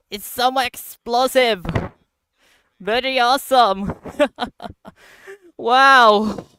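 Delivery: loud, high excited gasps with laughter; can't believe how strong it is; amazed. Real, not acted. Gamer marvelling at the explosion, loud giddy excitement.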